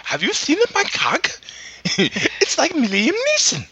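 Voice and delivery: squeaky boastful Jar Jar bit. Pretend.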